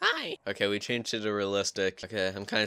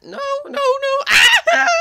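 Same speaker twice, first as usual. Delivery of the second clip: drawn-out no, no, no rising to a high loud shriek; panicked scream; 12 dB louder, slower than usual. Real, not acted. Gamer cornered by zombies, screaming no in panic. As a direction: panic as it gets you.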